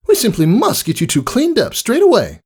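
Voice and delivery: high, elegant and polite; gracious welcome; refined lady unicorn. Acted. Rarity greeting Rainbow Dash at her door, refined politeness.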